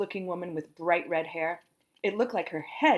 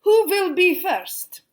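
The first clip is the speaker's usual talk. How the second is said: high, slow, loud grand teacher voice; dance teacher voice; 11 semitones higher, 9 dB louder, slower than usual. A narrator's reading. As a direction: sweep the room with a grand call. Dance teacher addresses class.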